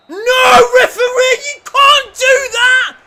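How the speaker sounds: very high, loud shout at the referee; outraged protest. Real, not acted. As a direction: scream in protest, stretching the last words.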